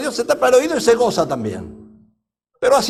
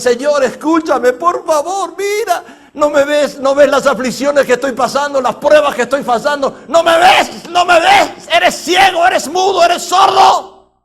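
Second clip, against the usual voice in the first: high, loud plea building to a full shout; desperate shouting; 8 semitones higher, 7 dB louder than usual. Acted. Worshippers yelling at God to be heard, desperate shouting. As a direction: shout it higher and louder, stretching the last word. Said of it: "Gritándole".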